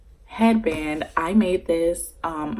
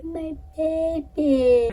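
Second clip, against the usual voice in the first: much higher, slow, dazed coo; drugged and dreamy; 11 semitones higher, 4 dB louder, much slower than usual. Retold or imitated. New mother receiving her baby, slow drugged coo. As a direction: coo it like you're drugged and in love. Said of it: "I was doped the fuck out".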